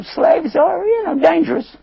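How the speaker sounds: very high, mock-cautious aside; high suspicious aside; wary master. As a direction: warn about the slaves. [name] voices his wariness.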